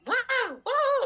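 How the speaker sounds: high, drawn-out mock shout of wow; playful imitation of a loud teen. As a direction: imitate a teenager's booming voice, lightly. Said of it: "suaranya kenceng".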